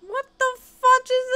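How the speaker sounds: high, loud what-the-fudge shriek; startled gamer squeal; shocked outburst. Real, not acted. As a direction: blurt it out in disbelief. Shocked at strange game sims.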